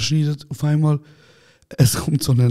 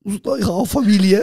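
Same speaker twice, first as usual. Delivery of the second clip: pleading imitation; 6 semitones higher than usual. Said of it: "sagt er".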